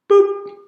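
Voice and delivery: short, high-pitched boop; button-press boop. Retold or imitated. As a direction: pop out a tiny high button noise. Imitates pressing a magic button.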